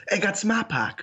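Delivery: a hint of a Boston accent; mock Boston accent. Co-host retelling Nashville fans faking a Boston accent. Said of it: "people walking down Broadway in Nashville going".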